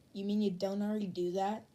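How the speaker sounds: plain reading voice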